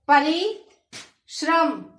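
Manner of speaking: परिश्रम drawn out very slowly, each sound apart; slow syllable-by-syllable. Shown as a demonstration. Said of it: "वर्ण विच्छेद को बोलकर देखेंगे".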